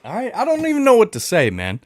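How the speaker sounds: ordinary talk, nothing marked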